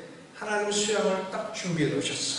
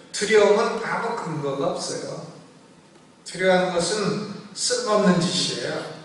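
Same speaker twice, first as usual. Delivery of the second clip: normal talking voice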